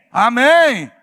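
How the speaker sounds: animated pulpit voice growing loud and high; preaching building to full force. Real, not acted. Preacher testifying about depending on God, preaching building in force. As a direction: push from testimony into a rousing call.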